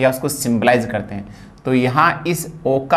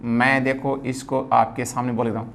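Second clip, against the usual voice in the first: ordinary talk, nothing marked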